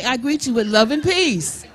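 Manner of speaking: loud, ringing call to the room; call for the crowd to repeat. Shown as a demonstration. Singer leading the audience greeting, called out loud. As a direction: lead the crowd line by line, calling out.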